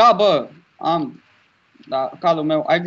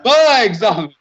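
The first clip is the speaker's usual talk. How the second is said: alarmed shout; 8 semitones higher, 6 dB louder than usual. Real, not acted. Horse falls into water.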